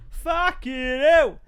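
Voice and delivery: high, loud, slow "Fucking hell!"; loud curse. Shown as a demonstration.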